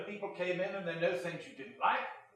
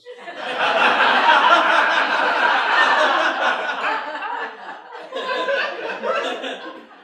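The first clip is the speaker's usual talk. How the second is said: loud laughter; 12 semitones higher, 12 dB louder than usual. Real, not acted. Big laugh at the joke.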